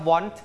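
WANT said clearly with a sounded final t; "want" pronounced distinctly, every sound there; clearly enunciated. Shown as a demonstration. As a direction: model the word "want" crisply for a pupil. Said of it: "ออกเสียงชัดๆครับ".

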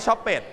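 "shop it" with ED as a full extra syllable; mispronounced "shop it", ending sounded as "pet"; wrong ending. Shown as a demonstration. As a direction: say "shop it" in two beats, like "shop-pet". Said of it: "ไม่มี shop it".

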